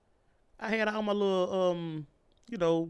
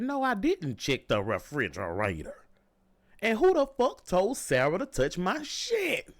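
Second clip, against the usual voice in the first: slow, menacing, pointed threat; threatening; 5 semitones higher than usual. Retold or imitated. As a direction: lean into each word like a warning. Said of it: "I was threatening these people".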